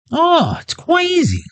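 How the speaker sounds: high comic shout. Acted.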